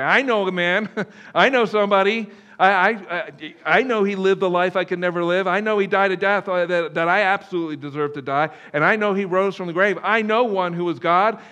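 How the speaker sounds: imagined John, loudly vouching for someone; eager John speaking up. Acted. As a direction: burst out as the proud eyewitness. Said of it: "John would stand up and say".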